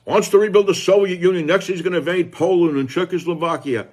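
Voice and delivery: high, loud sneering parody of a pundit; mock pundit outrage; mocking the warmongers. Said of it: "the warmongers out there like Levin and Hannity say".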